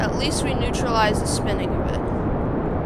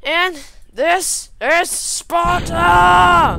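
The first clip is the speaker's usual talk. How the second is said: very loud, high, drawn-out war shout; mock battle cry; 7 semitones higher, 10 dB louder, much slower than usual. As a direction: bellow it slowly, stretching the last word out.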